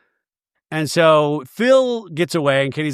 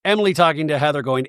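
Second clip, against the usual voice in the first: ordinary talk, nothing marked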